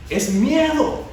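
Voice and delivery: rising, urgent sermon voice; fiery preaching. Acted. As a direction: preach it out to the whole church. Preacher builds to full force.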